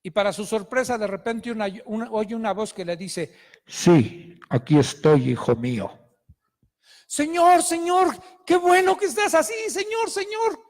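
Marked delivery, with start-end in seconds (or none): 3.7-5.9 much lower, loud, booming divine voice; voice of God. Acted. God answers the hanging climber. 7.1-10.6 much higher, loud, eager cries; relieved pleading climber. Acted. Climber overjoyed, calling to God.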